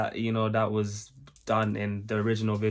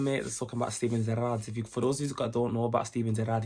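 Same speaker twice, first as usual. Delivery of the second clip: ordinary delivery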